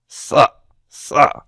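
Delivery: throaty constricted S sound; Sa, sa with a tight pharyngeal S; emphatic tsadi. Shown as a demonstration. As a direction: squeeze the throat while saying Sa, sa. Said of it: "an S sound with constriction in the throat".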